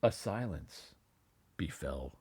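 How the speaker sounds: plain reading voice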